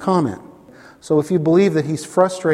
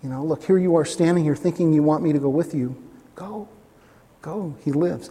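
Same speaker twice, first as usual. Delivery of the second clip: tender assurance. Retold or imitated. Jesus looking the father in the eyes, tenderly. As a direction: reassure him face to face. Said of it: "That's Jesus".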